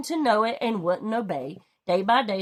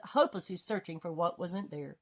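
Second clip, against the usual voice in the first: ordinary delivery, nothing marked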